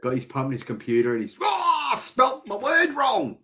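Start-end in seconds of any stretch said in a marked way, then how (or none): 1.4-3.4 much higher, slow hammed-up cry; mock excited viewer. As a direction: overplay the fan's dismay. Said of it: "He's excited".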